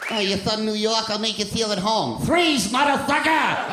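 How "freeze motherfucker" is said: mock cop shout. Comic mock cop shouting at New Yorker.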